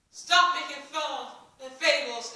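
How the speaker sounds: slow, loud angry order. A narrator's reading. Villager shouts Ellie down, slow and loud. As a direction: shout her down, slowly. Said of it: "Ella said".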